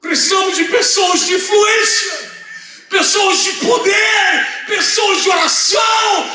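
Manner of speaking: high, slow pulpit proclamation; preaching at full force. Real, not acted. Preaches a list of needs.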